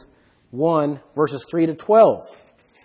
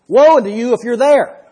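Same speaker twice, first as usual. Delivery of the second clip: raised, higher and louder preacher's warning; solemn warning; 5 semitones higher, 6 dB louder than usual. Acted. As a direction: sound the alarm from the pulpit like a prophet.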